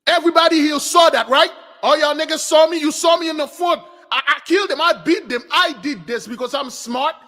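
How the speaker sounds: high, insistent bragging; boastful. Retold or imitated. Zippo calling on everyone who saw him, high-pitched boasting. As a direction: crow about it to a crowd of witnesses. Proud victor boasting to the crowd.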